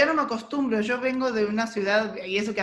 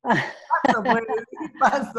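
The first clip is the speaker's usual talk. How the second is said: laughing through the words; 3 dB louder, slower than usual. Real, not acted. Host laughs while telling guest he can pass. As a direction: chuckle it out, amused. Co-hosts joke about skipping question.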